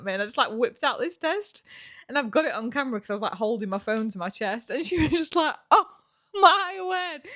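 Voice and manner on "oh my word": high, laughing, teary gasp; delighted shock. Retold or imitated. Mum seeing the pregnancy test, high laughing teary gasp. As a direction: gasp with tearful joy.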